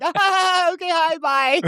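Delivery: very high squealed nervous greeting; panicked brush-off. Retold or imitated. Returning key past crazy guy, panicked high brush-off. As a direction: squeal it to get away from him.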